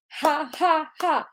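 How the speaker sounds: ha ha ha with a strong breathy H; soft, airy ha ha ha, H aspirated. Shown as a demonstration. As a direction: blow out each ha with heavy H breath. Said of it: "with a H, which is very, very aspirated".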